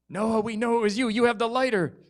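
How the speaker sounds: high, pressing reproach; big sister insisting; accusing sister. Acted. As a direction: pin the blame on him.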